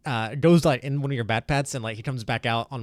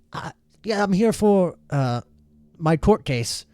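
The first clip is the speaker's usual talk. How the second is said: character voice; 7 semitones higher, 5 dB louder, slower than usual. Acted. GM voices a person at reception.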